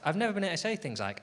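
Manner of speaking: normal talking voice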